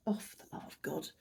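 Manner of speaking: whispered despair at her own joke; muttered under the breath at the corny joke; exasperated whisper. Real, not acted. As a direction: breathe it out, half-voiced and defeated. Exasperated aside after a bad joke.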